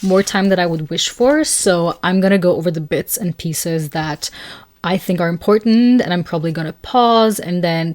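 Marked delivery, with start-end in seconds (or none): none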